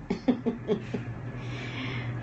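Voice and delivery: soft, breath-only laugh. Real, not acted. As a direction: chuckle quietly on the breath. Laughs after attempting the name.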